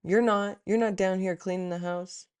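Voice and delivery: venting at God while scrubbing the house, mock angry; angry retort. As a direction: snap back at spirit in retold frustration.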